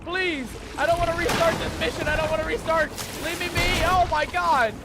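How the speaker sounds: high, loud wail of protest; desperate pleading. Real, not acted. Despairing pleas as pirates attack.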